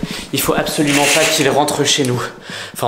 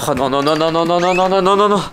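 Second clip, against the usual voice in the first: rising panic; 2 semitones higher than usual. Acted. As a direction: panic more with each no. Piggy escaping, panic builds.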